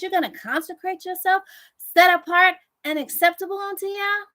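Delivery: high, scornful mock questions; the enemy scoffing. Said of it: "Listen to what he says".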